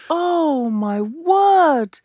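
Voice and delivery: high, breath-taken exclamation of delight; awed anticipation. Real, not acted. Host about to hear guest sing, awed.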